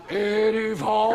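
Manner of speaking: slow, slurred nonsense word; drunk gibberish. Retold or imitated. Mock drunk word sung at a party. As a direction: drawl it like a drunk. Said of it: "sounded like a drunk man".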